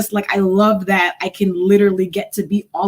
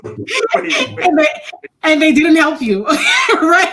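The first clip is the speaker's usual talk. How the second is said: laughing; 9 semitones higher, 5 dB louder, much slower than usual. Real, not acted. Laughing at own story.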